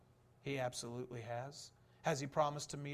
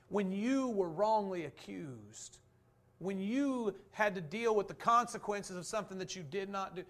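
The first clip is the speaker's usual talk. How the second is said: rising preaching; 5 semitones higher, 7 dB louder than usual. Acted. Preacher telling the hurting they are understood, fervent. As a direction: drive the point home with fervor.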